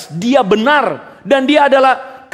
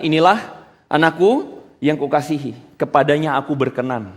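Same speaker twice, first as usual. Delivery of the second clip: voice from heaven; 6 semitones lower than usual. Retold or imitated. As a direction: proclaim it gently like God from the sky. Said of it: "ada suara dari langit".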